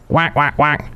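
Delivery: a light quacking noise; duck quack. Acted. Host imitates a duck, a light quack. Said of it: "Kinda looks like a duck".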